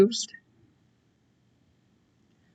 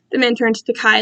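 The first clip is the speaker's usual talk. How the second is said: ordinary delivery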